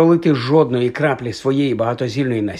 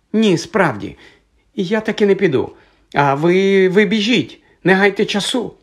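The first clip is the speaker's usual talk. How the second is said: high-pitched, insistent refusal; piercing voice; 7 semitones higher than usual. A narrator's reading. Hermione urges the boys to go without her, piercingly. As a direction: stay high, sending them off. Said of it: "пронизливий голос".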